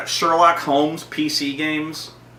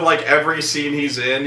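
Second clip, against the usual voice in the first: usual speaking voice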